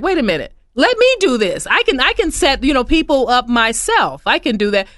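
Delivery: high, eager voice of a client taking charge; mock client. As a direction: play the keen client doing it herself. Clients voiced, excited self-setup.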